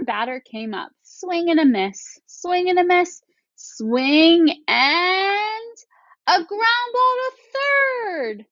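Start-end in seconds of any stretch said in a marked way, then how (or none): 1.0-5.8 higher with each swing, growing tension; building excitement. Shown as a demonstration. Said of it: "dragged out my words at those ellipses". 6.3-8.5 much higher and slow, stretched words; dragged-out excitement. Shown as a demonstration. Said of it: "dragged out my words".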